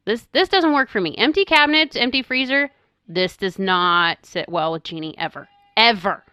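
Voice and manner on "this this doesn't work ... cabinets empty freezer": higher-pitched mock alarm; retold alarmed protest. Mother reliving her empty freezer worry, in higher-pitched alarm.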